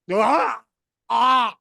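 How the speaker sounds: short, loud pained cries of "ah"; pained groans. Retold or imitated. Narrator arrives injured at work.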